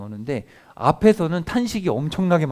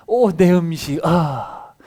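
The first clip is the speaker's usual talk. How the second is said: hammed-up sigh over burnt food, louder; a playful groan of loss; mock lament; 4 semitones higher, 7 dB louder, much slower than usual. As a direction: wail playfully at ruined dinner.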